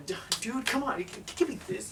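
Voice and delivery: high, stammering impatient plea; mock exasperation. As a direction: act out grabbing it away, voice climbing high. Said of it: "that's what I felt like doing".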